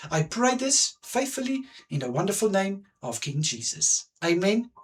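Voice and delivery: ordinary delivery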